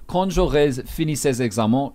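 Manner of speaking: clean French pronunciation; French demo. Shown as a demonstration. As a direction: read it out the way it should sound in French. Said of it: "I'll give you a sense".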